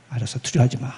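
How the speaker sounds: soft, low reassurance; calm a frightened friend gently; reassuring. Retold or imitated. 안심을 시켜놓는 거예요.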